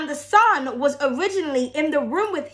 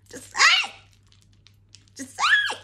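very high squeaky voice; cartoon-high playful squeak; squeaky high tease; much faster than usual. Repeating phrase climbing in pitch.

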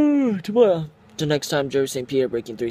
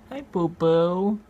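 talking sweetly to the pet in its cage, high and slow; cooing pet talk; 8 semitones higher, slower than usual. Real, not acted. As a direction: coo the pet's name, drawn out and high.

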